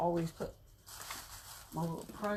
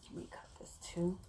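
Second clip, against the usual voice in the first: whispered aside; 9 dB quieter than usual. Real, not acted.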